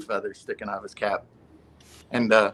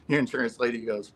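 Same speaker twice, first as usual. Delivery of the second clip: ordinary talk, nothing marked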